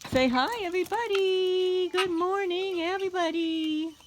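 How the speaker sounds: high cooing greeting to her chickens; talking to animals. Real, not acted. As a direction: chirp it up high to the birds.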